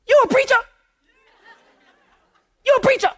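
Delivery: put-on high-pitched little old lady voice; mimicked old lady, sharply high question.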